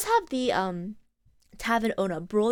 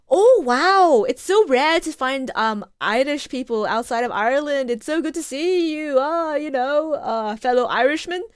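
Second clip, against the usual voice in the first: loud, gushing mock delight; imitated delight; 4 semitones higher, 6 dB louder than usual. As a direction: act out a thrilled visitor greeting a compatriot. Delighted Irish family, retold.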